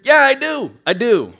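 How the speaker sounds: jittery admission; nervous burst. Real, not acted. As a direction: blurt it out nervously. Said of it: "makes me real nervous to be here".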